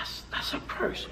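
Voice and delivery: breathy whispered verdict. Real, not acted. Preacher whispers the curse to the congregation, hushed. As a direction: whisper it gravely.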